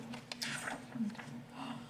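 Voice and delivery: soft breathy gasp of alarm; startled gasp. Acted. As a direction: catch your breath in worry. Turtle is missing.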